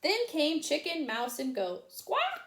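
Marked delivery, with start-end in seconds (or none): 1.9-2.4 high squawk. A narrator's reading. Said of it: "said Chicken".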